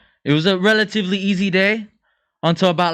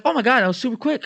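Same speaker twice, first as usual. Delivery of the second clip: surprised; 8 semitones higher, faster than usual. Retold or imitated. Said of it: "She's like".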